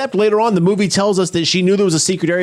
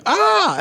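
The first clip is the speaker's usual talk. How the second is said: mock scream. Claire wakes up from a dream, mock screaming. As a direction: mimic waking up screaming. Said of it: "wakes up from a dream screaming".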